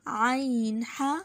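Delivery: आइन ह very slow, from mid throat; throat letter demo. Shown as a demonstration. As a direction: squeeze आइन ह out of the middle of the throat. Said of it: "ये हलक से अदा होते हैं".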